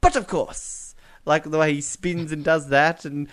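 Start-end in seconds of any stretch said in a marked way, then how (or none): 0.0-0.9 raised, mock-servile "But of course"; a lightly voiced Basil Fawlty flourish; Fawlty Towers line. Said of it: "does the famous, um, Fawlty Towers line".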